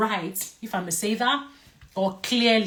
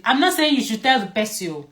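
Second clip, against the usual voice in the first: ordinary talk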